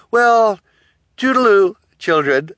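high, loud singsong farewell; mocking villainess. A narrator's reading. Guardian leaving orphans trapped, mockingly cheery and loud. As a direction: trill it out as a taunt.

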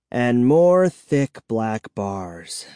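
plain reading voice

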